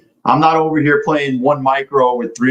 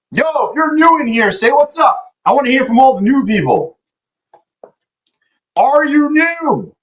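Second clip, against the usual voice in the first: high hype greeting; 12 semitones higher than usual. Real, not acted. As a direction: hype up the new viewers. Greeting new chat viewers.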